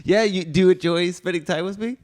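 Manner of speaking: high, loud hammed-up questioning; mock Trump interviewer. S1 voicing Trump pressing Melania, high and loud. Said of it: "She's like".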